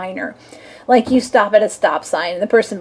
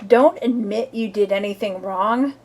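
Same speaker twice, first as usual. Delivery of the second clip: ordinary delivery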